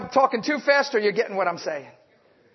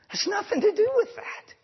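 normal talking voice

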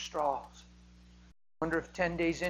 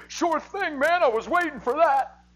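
eager reply; 10 semitones higher, 8 dB louder than usual. Pretend. Imagined eager reply to Jesus.

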